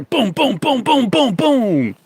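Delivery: high, loud playful booms; mock excited booms. Pointing out imagined Bigfoots with loud, high booms. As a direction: punch each boom like spotting one.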